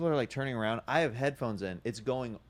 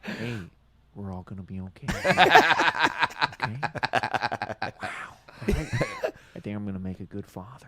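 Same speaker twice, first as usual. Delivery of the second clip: a hint of Owen Wilson, laughing through it; Owen Wilson impression; 7 dB louder, slower than usual. Comedian's Owen Wilson calming a kid on a plane, lightly voiced with laughs. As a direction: drift the Cage bit into Owen Wilson. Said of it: "it'll just turn into my Owen Wilson".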